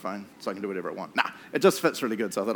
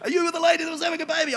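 hurried nurse; 13 semitones higher, much faster than usual. Retold or imitated. Nurse rushing up to a frantic husband, high fast question. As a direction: rush it out like a nurse. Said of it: "she comes boosting over".

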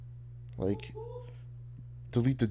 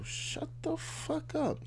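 high mocking voice; 10 dB quieter, much slower than usual. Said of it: "It's like, yo".

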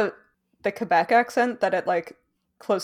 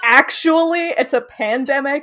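higher, louder pedantic correction with laughter; 6 semitones higher, 6 dB louder than usual. Acted. Podcast host mocking their own nitpick about Twilight, pedantic. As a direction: correct them like a pedant, hammed up. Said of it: "get, like, super pedantic about it".

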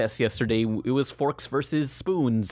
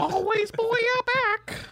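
much higher and slow, squeaky squid chant; squid kid voice; much slower than usual. Pretend. In the squid voice game, a slow, high squeaky cheer. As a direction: stretch it out like a cartoon squid kid.

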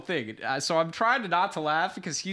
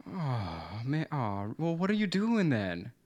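deflate with disappointment; disappointed; 4 dB quieter, slower than usual. Retold or imitated. Disappointed fan.

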